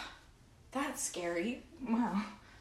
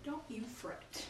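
quiet echo; 19 dB quieter, slower than usual. Acted. Echo, softly.

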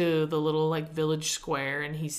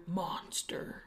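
whispered; 10 dB quieter than usual. Retold or imitated. Quoting Roxy turning away.

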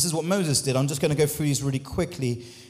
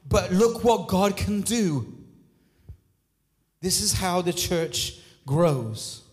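preaching; slower than usual. Acted. Slow preaching over music.

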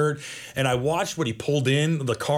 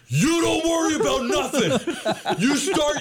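high, forceful mobster reassurance; full Scorsese; 13 semitones higher than usual. Retold or imitated. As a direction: bellow orders like a tough Italian protector. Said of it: "he goes full Scorsese".

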